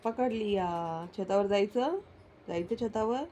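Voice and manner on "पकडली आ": पकडली आ stretched out very slowly; drawn out. Real, not acted. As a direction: say पकडली आ slowly, dragging every syllable. Caught pet, drawn out. (पकडली आ खूप सावकाश ओढून)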